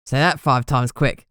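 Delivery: joking Cockney bloke; thick Cockney. A narrator's reading. Ghoul informant joking with the detective, thick Cockney. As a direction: crack the joke in broad Cockney. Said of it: "The Cockney accent came out thick and broad".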